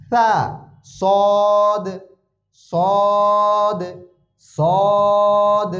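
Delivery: সিন thin and light, সদ thick and full; drilled Arabic letters. Shown as a demonstration. Teacher drills three similar letters for students, slow and exact. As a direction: contrast সিন, সা and সদ with slow held letter names. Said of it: "হরফগুলোর উচ্চারণের পার্থক্য খেয়াল করেন".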